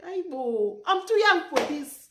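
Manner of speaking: high, loud retold outcry; exclaimed protest. Young pupil protesting at the teachers, loud exclaimed protest. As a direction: cry out in hammed-up disbelief.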